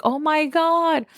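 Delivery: high, thrilled oh my god; excited disbelief. Retold or imitated. Bride too excited to eat at her party, high thrilled exclamation. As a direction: burst with giddy joy. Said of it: "And I was like".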